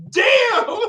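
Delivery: shrieking laugh into a groan; high cackling laugh. Real, not acted. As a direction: laugh hard then sigh it out. Laughing fit after own joke.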